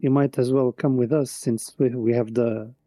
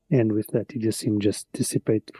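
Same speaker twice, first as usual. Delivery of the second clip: ordinary talk, nothing marked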